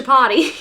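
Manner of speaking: "chapati" said as cha-PAH-tee for Chipotle; "chapati", with the stress on PAH; mock mispronunciation. Small-town critics who mangle Chipotle, said as "chapati". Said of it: "they would pronounce it as".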